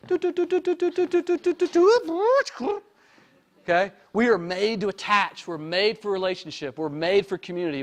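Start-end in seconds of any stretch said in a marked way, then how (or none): 0.1-2.8 high, buzzing repeated doo sounds, slowing at the end; mock brain alarm droning doo doo doo. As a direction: hum like a radar locking on to a target. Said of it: "their brain will go".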